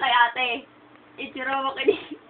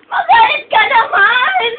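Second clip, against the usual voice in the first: high, loud, slow mock demand; exasperated high holler, played up; shouted plea; 10 semitones higher, 9 dB louder, slower than usual. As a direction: beg loudly with each word stretched. Begging Ichiro to get angry.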